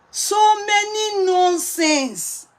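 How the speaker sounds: stretched slow and high, so many nonsense; drawn-out complaint. Real, not acted. Wife complaining about her husband's mess, slow exasperated drawl. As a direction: drag it out in exasperation.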